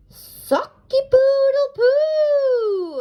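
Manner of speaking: high, loud, slow silly crow; failed crow again. Acted. Rupert tries crowing again, loud silly crow. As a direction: another botched crow, strained. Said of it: "Rupert tried again".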